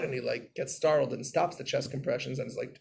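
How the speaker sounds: ordinary delivery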